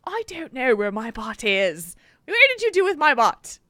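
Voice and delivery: put-on a new silly puppet accent; spider in a different accent. Parent voicing the smacked spider in a new silly accent. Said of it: "he would have a different accent".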